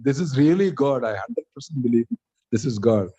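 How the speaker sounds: ordinary talk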